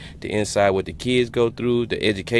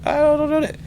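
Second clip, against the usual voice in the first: much higher, mock baffled voice; baffled reaction; 3 dB louder than usual. Reacting to accents on The Wire, baffled high mock voice. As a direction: play up your own confusion at the accent. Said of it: "I'm like".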